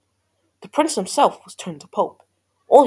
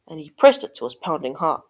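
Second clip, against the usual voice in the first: ordinary delivery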